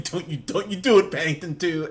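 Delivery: loud, high mock warning; about to cry. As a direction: plead loudly against an oncoming tearjerker. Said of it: "I swear I'm gonna start crying".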